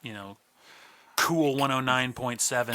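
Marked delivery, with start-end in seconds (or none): none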